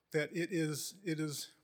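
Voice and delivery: ordinary delivery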